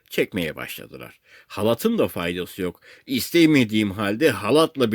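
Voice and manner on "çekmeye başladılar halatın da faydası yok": usual speaking voice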